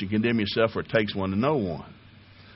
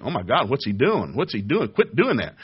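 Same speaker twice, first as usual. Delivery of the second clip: mock panic. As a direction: panic as the muscles, pitch climbing. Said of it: "they're panicking".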